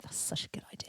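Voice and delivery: hushed whisper of a friend's praise. Retold or imitated. Friends quoted about the plan, in a whisper. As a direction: breathe it out, all air, no voice.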